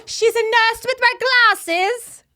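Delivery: high, loud mock British accent; posh English accent. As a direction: put on a shrill-free high English voice, hammed up. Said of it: "I can't do a normal English accent".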